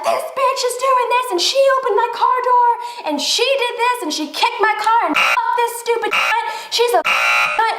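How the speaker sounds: high-pitched ranting, piling up complaints; going off. Retold or imitated. Driver venting to boyfriend about the woman at her car, going off. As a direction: reenact a furious rant, pitched well up. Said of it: "going off".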